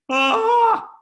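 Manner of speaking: sudden high loud panicked scream. Retold or imitated. Young recruit panicking over calling his list, high loud scream. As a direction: scream out the fear of rejection.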